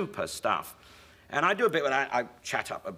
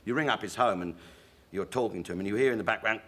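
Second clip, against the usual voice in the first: ordinary talk, nothing marked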